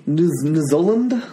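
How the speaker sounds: a put-on New Zealand accent, slow. Shown as a demonstration. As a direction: drawl it like a Kiwi. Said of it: "sound like".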